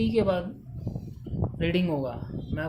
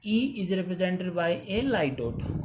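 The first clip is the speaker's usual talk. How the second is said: each word of "E is represented by a light dot" spaced out slowly; teacher dictating the rule word by word; slow dictation; much slower than usual. Shown as a demonstration. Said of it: "reading the rule slowly".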